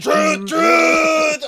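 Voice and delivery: very high, loud honking; long, blaring horn imitation; truck horn honk. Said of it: "the honk".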